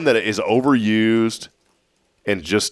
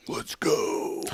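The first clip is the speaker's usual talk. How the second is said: soft, whispery cheer, higher; hushed mock hype; 8 semitones higher, 9 dB quieter than usual. Host celebrating finding a parking spot, hushed mock hype. Deflated echo of the hype phrase.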